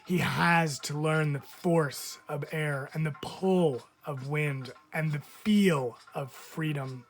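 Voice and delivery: usual speaking voice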